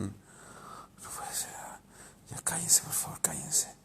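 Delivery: breathy whispered plea to be quiet; desperate whisper. Retold or imitated. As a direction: whisper it as if at the end of your rope. Inner plea, kids shouting. (susurro desesperado)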